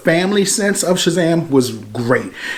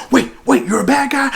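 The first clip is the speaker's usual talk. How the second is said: surprised; 10 semitones higher than usual. Retold or imitated. Kid surprised by villain.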